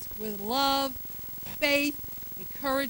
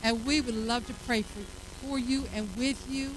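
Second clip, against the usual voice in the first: soft, low invitation over music; gentle altar call; 3 semitones lower, 4 dB quieter than usual. Acted. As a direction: invite them to come forward.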